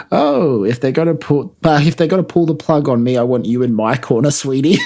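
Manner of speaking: Homer quote. Retold or imitated. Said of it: "Homer says".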